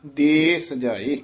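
ordinary delivery

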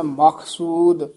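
"ਮਖਸੂਦ" slowly, with a clean aspirated kh, not k; "ਮਖਸੂਦ" with a clear ਖ, not a ਕ; clear kh sound. Shown as a demonstration. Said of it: "ਖੱਖਾ ਸ਼ੁੱਧ ਬੋਲਣਾ ਹੈ".

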